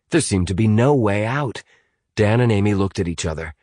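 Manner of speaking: usual speaking voice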